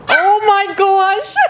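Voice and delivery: slow, high, laughing exclamation; overwhelmed delight. Real, not acted. Vlogger seeing the bench take shape, overwhelmed delight. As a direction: stretch it out, high and loud, laughing.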